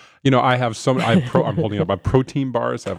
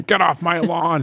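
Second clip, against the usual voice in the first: put-on slow, higher cranky old-timer voice; grumpy old man; 6 semitones higher, slower than usual. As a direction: play the cranky old man yelling at kids. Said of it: "I'm sounding like an old man".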